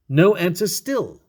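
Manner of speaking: usual speaking voice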